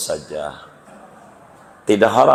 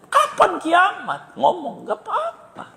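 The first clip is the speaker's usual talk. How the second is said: much higher, laughing, light mock voice; voicing the Prophet. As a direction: play it as amused and kind. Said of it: "Itu nabinya". (suara Nabi yang tinggi sambil tertawa)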